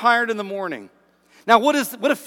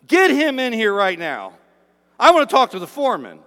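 mock angry worker shouting, louder; grumbling workers; 2 semitones higher, 6 dB louder than usual. Preacher acts out workers demanding the union rep, loud and indignant. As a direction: ham up an indignant day labourer. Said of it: "you can hear the grumbles".

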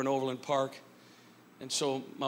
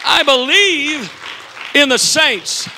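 emphatic preaching; 11 semitones higher, 14 dB louder, slower than usual. Acted. Slow emphatic close of climax.

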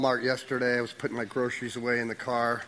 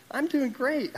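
lightly voiced little girl, much higher; 13 semitones higher, slower than usual. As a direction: imitate a cheerful little girl, pitched way up. Retold talk with sick little girl.

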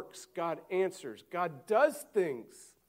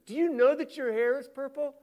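higher, chirpy dad stating the obvious; 11 semitones higher, 9 dB louder than usual. Retold or imitated. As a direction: play a clueless dad telling his teen the obvious. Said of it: "This is the dad going up to his fourteen-year-old daughter".

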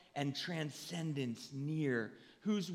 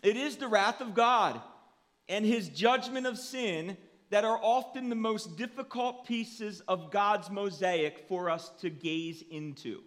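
loud, raised preaching voice; forceful preaching; 5 semitones higher, 7 dB louder than usual. Real, not acted. Pastor preaching on God's wrath and judgment, forceful preaching. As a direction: preach it out with full projection.

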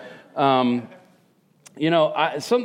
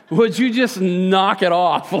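slow, loud, fed-up plea; exasperated plea; 5 semitones higher, 6 dB louder, slower than usual. Retold or imitated. Speaking to a smug happy couple, loud and fed up. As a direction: drag it out like you can't take any more.

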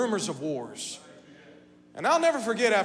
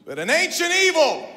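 much higher and louder, booming the words; loud declaration; 11 semitones higher, 12 dB louder than usual. Acted. Preacher announcing the evil to the church, loud boom. Loud opening before quiet repeat.

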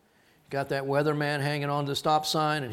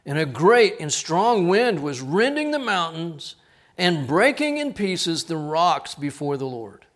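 louder, swelling scripture read; building scripture reading; 7 dB louder than usual. Acted. Preacher reading the mighty wind to the congregation, loud. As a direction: let the wind roar through the verse.

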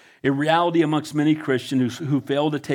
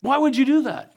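incredulous question; 8 semitones higher than usual. Retold or imitated. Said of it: "some people will go".

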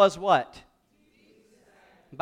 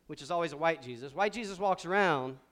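ordinary talk, nothing marked

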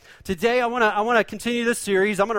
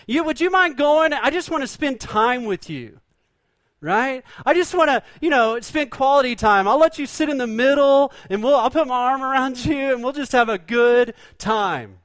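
higher, coaxing mock sweet-talker; 4 semitones higher than usual. Pastor voicing grandpa sweet-talking her into the truck, coaxing. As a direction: lay on the charm to get her along. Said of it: "sweet talk you in to going to check the cows".

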